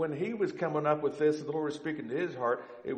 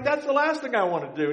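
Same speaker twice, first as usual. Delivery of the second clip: higher, louder, exasperated protest; indignant refusal; 11 semitones higher, 5 dB louder than usual. Retold or imitated. Husband rejecting his wife's advice, higher and louder. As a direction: toss it off as the last thing you'd ever do. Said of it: "He's like".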